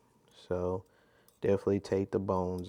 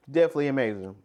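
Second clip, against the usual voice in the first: ordinary talk, nothing marked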